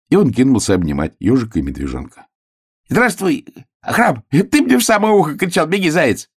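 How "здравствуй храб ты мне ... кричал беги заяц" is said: high, eager little voice; excited hare. A narrator's reading. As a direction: greet friends high and gleefully. Hare joyfully greets his friends. (высокий радостный голос зайца)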